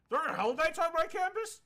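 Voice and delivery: high, loud mock shock; surprised archmage. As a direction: play the clueless old wizard, aghast. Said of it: "flash forward a bit".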